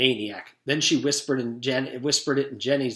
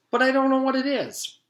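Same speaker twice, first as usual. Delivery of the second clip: exasperated; 13 semitones higher, 5 dB louder than usual. Acted. Calvin frustrated, high and loud.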